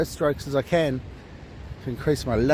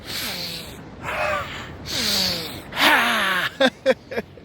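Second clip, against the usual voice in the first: demonstrated breathing; 4 semitones higher than usual. Shown as a demonstration. Showing viewers his one suggestion, breathy puffs ending in laughter. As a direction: huff out big breaths, then laugh. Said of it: "except for this".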